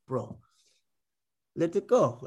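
muddled Russian-Cuban accent; badly faked Cuban accent, spaced out for profundity; mock Cuban accent. Shown as a demonstration. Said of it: "in a, in a Cuban accent".

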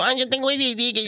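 put-on high, whiny teen claiming ADD; mock a whiny kid. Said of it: "los carajitos de ahora también dicen eso".

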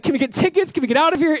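high-pitched mock panic; retold frantic pleading. Nervous husband hunting for tickets to get out, retold frantic pleading. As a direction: imitate desperate begging to escape. Seeking a way to avoid flying.

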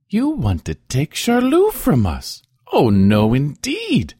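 loud, high, outraged peasant mother; indignant refusal. A narrator's reading. Peasant mother refusing to give up her son, indignant. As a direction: refuse loudly, pitch jumping up in outrage.

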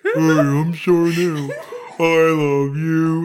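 loud, high, very slow sickly voice; mocking impression. Cohost mocking the host's impression, loud and slow. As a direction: drag it out like a pitiful drunk Barney. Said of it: "Like, what was that?".

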